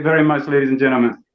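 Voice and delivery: ordinary talk, nothing marked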